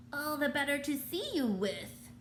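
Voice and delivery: put-on a sly wolf faking an old lady; wobbly fake granny. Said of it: "in the same wobbly voice".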